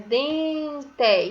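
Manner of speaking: "Dente" stretched as "deen-te"; syllable by syllable. Shown as a demonstration. Teacher showing which syllable starts the word, "Dente" broken in two. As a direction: sound out both beats of "Dente". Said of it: "Quantas vezes eu abri minha boca?".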